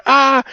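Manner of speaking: high, loud mock yell; short screech imitating a screamer; imitated scream. Retelling Sean yelling amid the debate, imitated scream. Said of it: "Sean just yelling and screaming".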